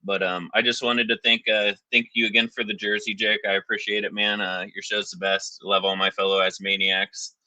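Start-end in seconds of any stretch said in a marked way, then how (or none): none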